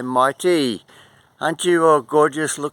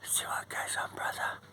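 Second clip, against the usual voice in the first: hushed whisper; soft whisper to the magpie; 16 dB quieter than usual. Real, not acted. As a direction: whisper it so the bird stays. Whispering close to bird.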